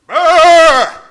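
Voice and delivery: loud high brr like a clown; loud silly clown noise. Retold or imitated. Naked comic jumping at his wife, loud clown brr. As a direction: burst out with a goofy clown sound.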